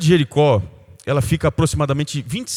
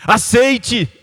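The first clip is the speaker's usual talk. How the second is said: louder, higher impassioned exhortation; fiery preaching; 6 semitones higher, 3 dB louder than usual. Acted. A pastor urging the congregation to forgive, preached full force. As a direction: preach it with rising fire.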